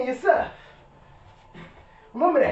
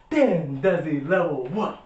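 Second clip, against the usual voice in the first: slow catchphrase; 7 semitones lower, slower than usual. Acted. Signature outro catchphrase again.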